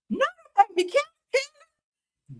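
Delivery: a high appalled yelp at a gross joke; high squeal of disgust. Real, not acted. Disgusted reaction to gross comment.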